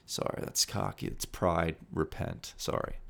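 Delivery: hushed, half-joking self-rebuke; sheepish whisper. Real, not acted. Host scolding himself for bragging, whispered. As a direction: whisper it like a guilty confession. Embarrassed aside after bragging.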